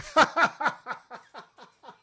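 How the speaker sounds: high, loud burst of laughter; long laugh. Real, not acted. Laughs after saying Bloomberg.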